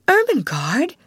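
startled cry. A narrator's reading. Sarah finding Ermengarde in her attic, startled cry. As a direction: catch her out in surprise. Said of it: "cried Sarah".

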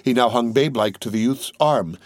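ordinary delivery

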